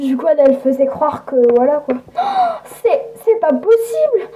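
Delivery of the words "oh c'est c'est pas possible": aigu, rieur, sidéré; n'y croit pas, rit; incrédule en riant. Acted. (rire incrédule et aigu)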